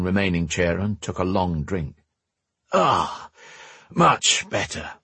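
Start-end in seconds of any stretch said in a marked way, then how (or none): 2.7-4.9 high, slow sigh of satisfaction; relieved old man's sigh; sighed relief. A narrator's reading. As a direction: sigh it out after a long drink. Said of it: "he sighed".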